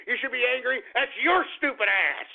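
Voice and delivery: ordinary delivery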